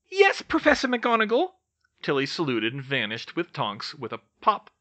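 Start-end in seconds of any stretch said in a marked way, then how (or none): 0.1-1.5 high, loud elf voice; high-pitched, dutiful reply; obedient house elf. A narrator's reading. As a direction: answer smartly as a servant elf. House elf obeys orders.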